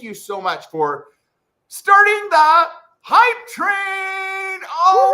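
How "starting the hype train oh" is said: very high, loud, stretched-out hollering; ecstatic shout. Real, not acted. Streamer thanking viewer for hype train, ecstatic shouting. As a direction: scream it in celebration.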